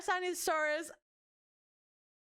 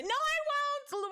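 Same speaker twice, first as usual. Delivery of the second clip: shocked denial; 3 dB louder, slower than usual. Real, not acted. Shocked self-correction.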